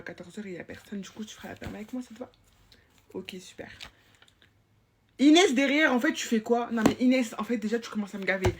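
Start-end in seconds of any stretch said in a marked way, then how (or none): 5.2-8.5 loud, exasperated scolding shout; fed-up supervisor shouting down the corridor; yelling at a pupil. Acted. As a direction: bark at the sixth-grader across the hall. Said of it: "je crie sur tout le monde".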